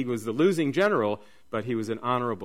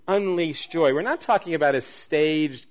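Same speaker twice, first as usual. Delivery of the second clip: ordinary delivery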